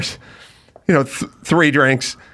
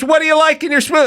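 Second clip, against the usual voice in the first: mocking bartender; 12 semitones higher, faster than usual.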